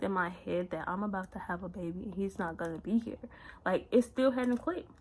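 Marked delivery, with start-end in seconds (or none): none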